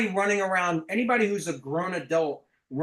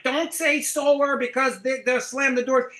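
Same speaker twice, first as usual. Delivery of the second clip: mock advice; 7 semitones higher, 4 dB louder than usual. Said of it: "All this stuff on the doors".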